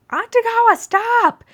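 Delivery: fleeing tiger shouting at his chaser; yelling. A narrator's reading. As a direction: yell it while running away. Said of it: "the were tiger yelling".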